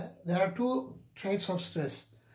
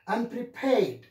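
"Unprepared" with "-pared" lifted out; primary and secondary stress; 3 semitones higher, slower than usual. Shown as a demonstration. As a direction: demonstrate "Unprepared" as un-pre-PARED. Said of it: "I should say".

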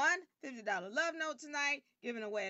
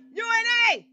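U N A yelled very loud and high; aggressive shout; 10 semitones higher, 17 dB louder, much faster than usual. Real, not acted. Host insisting the name is U N A, yelling loud and high. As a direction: blast out U N A. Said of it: "I'm a little bit aggressive tonight".

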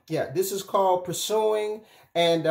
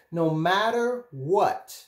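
"No matter what" drawn out slowly; slow, deliberate reassurance; much slower than usual. Real, not acted. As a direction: stretch out "No matter what" at usual volume. First take of repeated reassurance.

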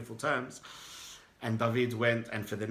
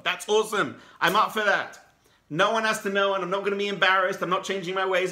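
excited outburst; 7 semitones higher, 7 dB louder than usual. Retold or imitated. David's joyful discovery of God.

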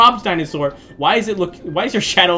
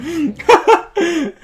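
high laugh; 10 semitones higher than usual. Real, not acted. Player killed in the game, high helpless laughter.

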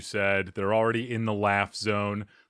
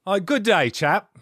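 mock British gentleman, higher pitched; proper English; 6 semitones higher, 4 dB louder than usual. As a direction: put on a formal English accent, louder. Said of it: "the proper English".

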